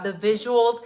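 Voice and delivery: normal talking voice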